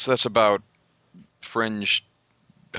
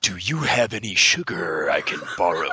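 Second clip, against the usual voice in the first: LaFontaine impression; 3 semitones lower than usual. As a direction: ask a small favour like an epic narrator. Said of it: "He really talks like that".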